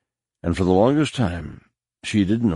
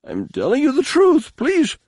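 frantic, much higher, pleading; 3 dB louder than usual. A narrator's reading. Captive begging.

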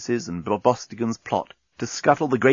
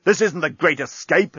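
exclaiming; 6 semitones higher than usual. A narrator's reading. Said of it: "exclaimed Bolfas".

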